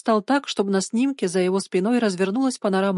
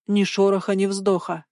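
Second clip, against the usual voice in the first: ordinary delivery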